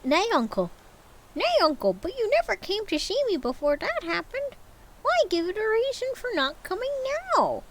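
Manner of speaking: high, cracking plea; pleading nephew. Acted. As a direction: beg your uncle warmly.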